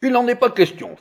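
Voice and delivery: high, loud flat refusal; indignant judge. A narrator's reading. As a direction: refuse outright, sharply. Judge refuses the offer outright.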